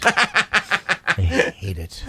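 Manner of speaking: drawn out, laughing through it; slow laughing repeat. Real, not acted. Co-host laughing his dislike of the song, slow and quiet. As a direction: stretch it slow while laughing.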